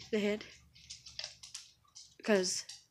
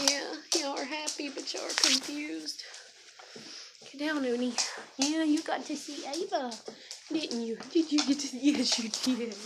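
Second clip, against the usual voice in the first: high, lilting pet talk; talking to dogs; 5 semitones higher than usual. Real, not acted. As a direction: coo playfully at excited dogs, pitched up. Excited chat to her dogs.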